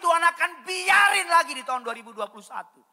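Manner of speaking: loud, high, forceful sermon delivery; full-force preaching. Real, not acted. Pastor warning that God's defence is no licence, full-force preaching. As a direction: thunder it out to the congregation.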